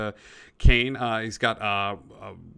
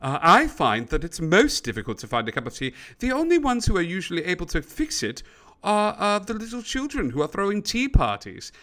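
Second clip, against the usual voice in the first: higher, swooping gentlemanly elephant; refined elephant character; 6 semitones higher than usual. Acted. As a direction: play a fussy tea-loving elephant, pitch climbing. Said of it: "the elephant goes".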